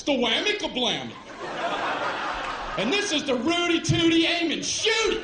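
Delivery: giddy shouting soldier; excited shout. Retold or imitated. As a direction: yell the silly names proudly. Soldier shouts silly names.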